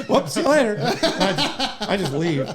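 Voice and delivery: high, loud mock goodbye through laughter; laughing. Officer jokes about driving off from someone in need, laughing. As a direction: toss it off while cracking up. Joking about fleeing a missed call.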